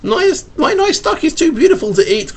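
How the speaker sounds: put-on high pleading child's cry; little boy pleading for his duck. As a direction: beg like a child saving his pet. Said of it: "cried the boy".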